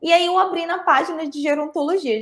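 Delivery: ordinary delivery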